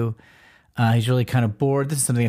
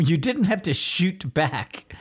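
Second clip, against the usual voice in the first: higher, drawn-out mock complaint; mock exasperation; 6 semitones higher, much slower than usual. Mister Miracle to Captain Atom after the blast, mock exasperated. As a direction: chide a teammate, stretching the words. Retold scolding of trigger-happy teammate.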